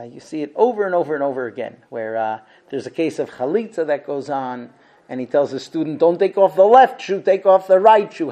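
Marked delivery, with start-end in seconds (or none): 6.0-8.3 firm correction. Retold or imitated. Teacher corrects student on shoe.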